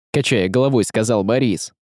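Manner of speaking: ordinary delivery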